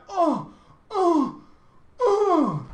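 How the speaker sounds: gasping at guild epics in Molten Core; ecstatic squeal. Retold or imitated. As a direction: squeal like you just saw treasure. Awestruck at more epic items.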